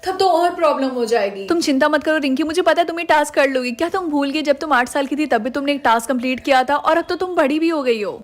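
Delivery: usual speaking voice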